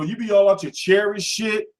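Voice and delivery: ordinary talk, nothing marked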